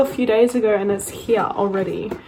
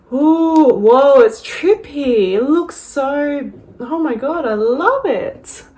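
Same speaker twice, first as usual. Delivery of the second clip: thrilled; 4 semitones higher, 6 dB louder, slower than usual. Real, not acted. Opening the palette, thrilled.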